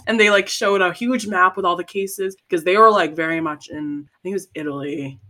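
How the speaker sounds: usual speaking voice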